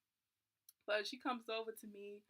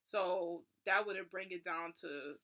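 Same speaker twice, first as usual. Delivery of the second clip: ordinary delivery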